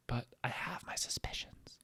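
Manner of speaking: hushed conspiratorial whisper. Real, not acted. Comic telling the crowd a secret, whispered. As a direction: lean in and breathe it like a secret.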